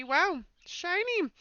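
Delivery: drawn-out wonder at the gem; awed. Acted. Finding a gleaming emerald in the swamp, slow awed wonder.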